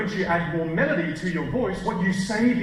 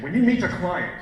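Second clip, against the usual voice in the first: normal talking voice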